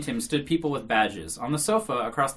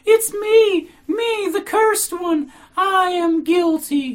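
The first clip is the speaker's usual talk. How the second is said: very high, loud, drawn-out wail; heartrending howl; 10 dB louder, much slower than usual. A narrator's reading. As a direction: howl it out slowly, all in tears. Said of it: "she cried in a heartrending howl".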